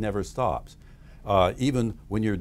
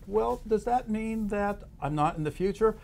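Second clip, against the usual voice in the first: high, innocent questioning voice; acted high-pitched curious question; mock interviewer; 9 semitones higher than usual. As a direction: play the polite interviewer probing gently. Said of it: "you interview them as if".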